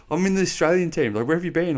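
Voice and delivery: high-pitched quoted exchange; retold protest. Player explains himself at the hotel entrance, high-pitched protest.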